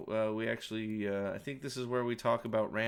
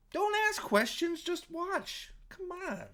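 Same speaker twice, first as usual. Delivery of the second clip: much higher mock voice, sarcastic; mocking squeaky voice; slower than usual. Mocking the show, higher squeaky voice telling viewers to just watch. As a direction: squeak it like the show shushing you.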